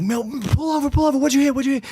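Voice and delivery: high, urgent, imitating own panic; panicked demand. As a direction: yelp at the driver to stop. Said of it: "I'm like".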